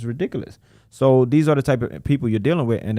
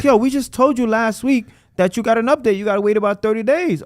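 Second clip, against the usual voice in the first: higher, put-upon staff voice; tired of repeating the same answer; exasperated agent; 11 semitones higher, 4 dB louder than usual. Retold or imitated. Agent telling the caller again to wait, higher and exasperated.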